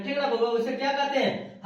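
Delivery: ordinary delivery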